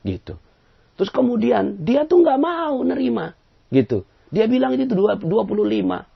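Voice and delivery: teacher complaining to Judge Bao about a stubborn man; indignant complaint. Retold or imitated. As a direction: plead your case to the judge.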